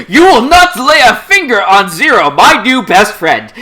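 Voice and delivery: loud, high, booming mock declaration; hammed-up protector. Jeremiah under the Geass, over-the-top protective proclamation. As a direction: defend a new best friend melodramatically.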